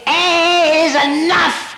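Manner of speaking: high, slow mock-pompous A is enough; mocking quote. Mocking people who say AA alone is enough, high and slow. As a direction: parody the know-it-all, drawn out. Mocking what others tell you.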